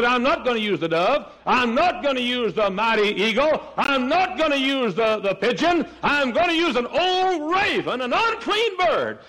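high, ringing pulpit proclamation; full-force preaching. Acted. Preacher voicing God choosing a raven, high impassioned preaching. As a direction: proclaim it high, each phrase building upward.

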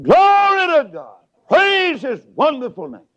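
high, loud cry of praise; joyful holler. Retold or imitated. Old father shouting praise from the house, joyful holler. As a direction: ring it out like an old saint shouting. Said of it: "his daddy's voice began to ring out and hollered".

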